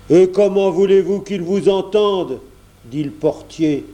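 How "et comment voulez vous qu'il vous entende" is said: high, loud grumpy porter; exasperated loud question; cross retort. A narrator's reading. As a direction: snap back irritably, voice raised.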